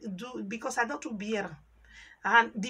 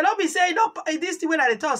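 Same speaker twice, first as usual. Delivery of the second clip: higher and louder, rushing; angry boss rebuke, loud and hurried; retold scolding; 5 semitones higher, 8 dB louder than usual. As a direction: tell them off fast. Quoting a scolding.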